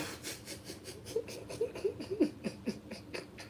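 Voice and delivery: a high, airy burst of laughter; sudden giggle breaking the sentence; high breathy laugh. Real, not acted.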